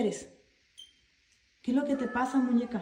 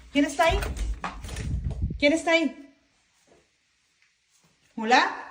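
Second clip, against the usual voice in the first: scared calling out; 5 semitones higher, 4 dB louder than usual. Real, not acted. Calling out to empty house.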